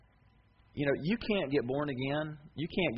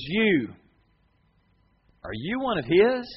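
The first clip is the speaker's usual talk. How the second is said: higher, louder challenge; accusing question; 8 semitones higher, 9 dB louder, slower than usual. Pretend. The world questioning a believer, higher and louder. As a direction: point the finger and demand.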